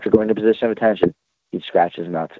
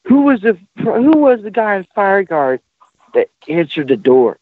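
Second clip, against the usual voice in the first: barking drill sergeant; 9 semitones higher than usual. Retold or imitated. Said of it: "drill sergeant goes".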